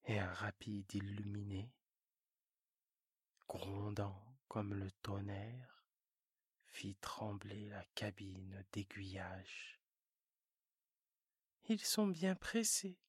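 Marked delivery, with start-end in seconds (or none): 0.0-9.8 low storyteller whisper; whispered narration. A narrator's reading. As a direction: whisper the thunder of the train. Narration of passing train.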